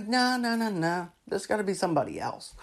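soft waving-away of the idea; mock dismissive. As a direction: brush it off under your breath, acting it out. Marvel imagined rejecting the idea.

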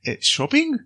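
high mock surprise; surprised. Harry to Hagrid, puzzled about shopping, high and surprised. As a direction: squeak out a puzzled question.

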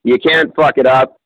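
normal talking voice

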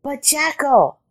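high rabbit protest. A narrator's reading. Rabbit objecting to Jackal's plan, high protest. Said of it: "protested the rabbit".

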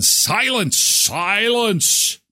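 high, drawn-out "silence"; high arching, stretched delivery; sudden silence. Retold or imitated. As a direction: sing out the word like a hushed reveal. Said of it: "and then all of a sudden".

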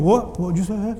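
young man at sports flaring up, mock aggressive; hothead picking a fight. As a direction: play the hothead spoiling for a fight. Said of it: "somebody looks at you and goes".

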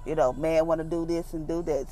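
ordinary talk

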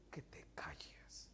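slow, low whisper; hushed command. Acted. God wanting the believer to stop talking, whispered. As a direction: breathe it out softly and slowly. God gently asks for silence.

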